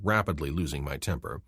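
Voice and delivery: ordinary delivery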